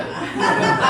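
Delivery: short unvoiced burst of laughter; amused laugh at the dead dog; breathy laugh. Real, not acted. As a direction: laugh at the absurdity. Laugh at own joke.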